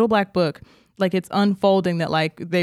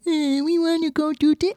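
put-on mousy breathy baby voice; 9 semitones higher than usual. Friend mimics actress's babyish delivery, mousy and breathy. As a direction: imitate a breathy little baby-talker, pitched up. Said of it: "like, very, um, mousy and breathy".

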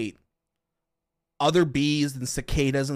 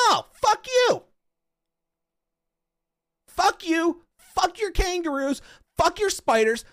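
mock angry rant; 13 semitones higher, slower than usual. Real, not acted. Streamer cursing out Australian animals, high mock-furious yelling. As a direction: yell it out in mock outrage.

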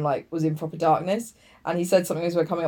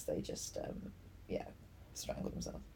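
drops to a whisper; 22 dB quieter than usual. Real, not acted. Whispering the grim detail to her friend.

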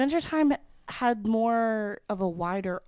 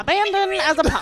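high announcer voice with a laugh; old radio announcer; 9 semitones higher than usual. Retold or imitated. Reciting show intro.

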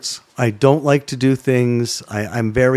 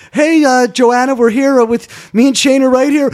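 put-on high, bright on-air girl voice, much higher and louder; girl on the radio; 12 semitones higher, 5 dB louder than usual. Mocking the girl's chirpy radio voice to the anchor. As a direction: chirp it out like a cheerful live reporter.

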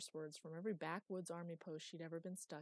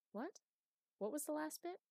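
sharp, high alarmed asking; jolted awake by the news; startled question; 10 semitones higher than usual. Acted.

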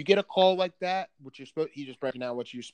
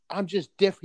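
higher, pleading retold quote; imitated tearful plea; 5 semitones higher, 4 dB louder than usual. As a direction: mimic someone mildly crying out. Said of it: "like, crying out".

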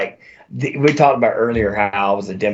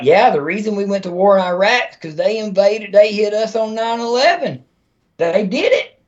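mocking dimwit; 10 semitones higher than usual. Mocking people who believe it.

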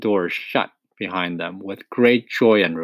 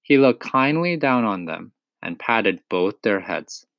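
usual speaking voice

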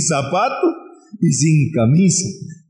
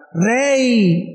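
higher pleading call to the king; 8 semitones higher than usual. Acted. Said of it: "empieza a gritar".

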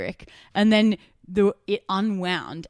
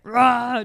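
clown roar; 5 semitones higher, 7 dB louder than usual. Retold or imitated. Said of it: "He's like".

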